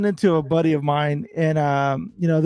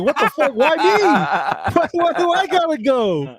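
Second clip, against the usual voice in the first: much higher, incredulous, a laugh in the middle; outraged disbelief; 10 semitones higher than usual. Retold or imitated. Told by security to leave, he protests in high disbelief. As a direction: squeak out indignant disbelief.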